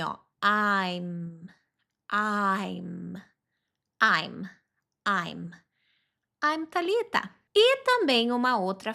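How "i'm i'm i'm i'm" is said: "I'm" said with the mouth opening wide, then closing on the M; open then close on M. Shown as a demonstration. Teacher showing students "I'm", mouth open then closed on the M. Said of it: "Abre a boca e fecha no M".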